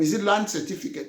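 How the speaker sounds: ordinary talk, nothing marked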